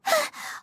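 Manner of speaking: sharp gasp of alarm; a quick frightened intake of air; startled gasp. Acted. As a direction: catch your breath in fright. Paimon startled by a noise.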